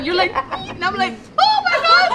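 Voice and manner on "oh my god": very high, loud squeal; thrilled shock. Retold or imitated. Friend hearing the engagement news, thrilled shock. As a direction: squeal it out, breaking into laughter.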